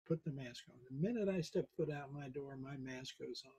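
usual speaking voice

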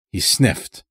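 plain reading voice

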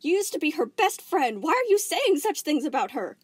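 high, loud, hurt indignation; indignant protest. Acted. Volleyball defending Pink against Spinel, indignant. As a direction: demand an answer.

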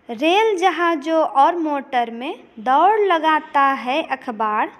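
slow, measured recital of a verse; steady classroom poem reading; poem recitation. Acted. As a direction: recite the lines to the class with rhythm. Teacher recites next stanza.

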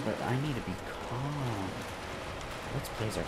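very soft self-soothing murmur; hushed, keeping calm. Real, not acted. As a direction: talk yourself down, very softly. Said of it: "I'm sorry if I'm whispering".

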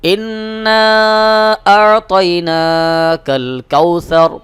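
slow melodic tajweed chant; ধীর সুরেলা তিলাওয়াত; Quran recitation. Shown as a demonstration. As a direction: draw out every vowel in recitation. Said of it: "প্রথম দুই আয়াত একসাথে আবার পড়বেন".

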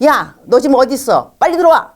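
high, loud command to come home; bossy order. Shown as a demonstration. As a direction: bark it like a nagging parent. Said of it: "이거는 you 메시지입니다". (높고 큰 소리로 다그치는 명령)

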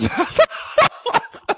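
high burst of real laughter; cracking up. Real, not acted. Storyteller at the handshake refusal, cracking up. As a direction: lose it laughing mid-story.

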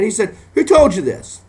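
rising preacher's cry; fiery preaching. Acted. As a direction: climb into a fiery preaching peak. Preaching climax.

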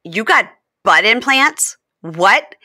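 mildly hammed-up disbelief; mock disbelief. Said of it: "And he goes".

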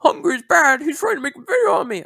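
whiny mock quote. Mocking Crimson's complaint about Hungry in a high whiny voice. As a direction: whine it like a tattling kid.

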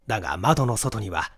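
plain reading voice

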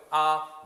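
"아" held long, high and level; first tone. Shown as a demonstration. Teacher leading learners to repeat, high level tone. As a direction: sing out "아" on one steady high note. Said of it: "그러면 안 돼요".

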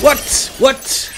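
high, startled what; surprised. Real, not acted. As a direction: disbelief at getting hit. Surprised at being hit.

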